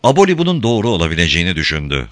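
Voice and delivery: ordinary delivery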